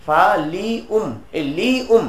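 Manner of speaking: ফা লি উম split apart slowly, each syllable clear; teaching the vowels of ফা লি উম slowly. Shown as a demonstration. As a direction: spell out ফা লি উম one slow piece at a time. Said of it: "এই লি উম প্রথমে কাসরা এরপরে জম্মা".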